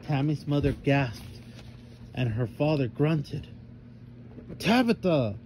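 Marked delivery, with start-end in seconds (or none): 4.6-5.3 sharp higher call of a name; a sister's warning shout, higher pitched. A narrator's reading. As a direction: snap the name at the little sister. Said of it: "Tammy shouted at her baby sister".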